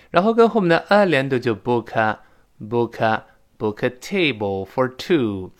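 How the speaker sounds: slow linked book a, book a, book a; teacher slowly models linking book into a. Shown as a demonstration.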